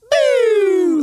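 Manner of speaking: high, loud mock boo; playful loud jeer. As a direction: boo the bad choice like a heckler. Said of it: "booing the Grammy result".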